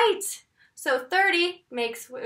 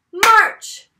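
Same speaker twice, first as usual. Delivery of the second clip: MARCH shouted loud and high; clapped and shouted; 6 semitones higher, 9 dB louder than usual. Shown as a demonstration. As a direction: belt out MARCH with a clap. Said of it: "One more time".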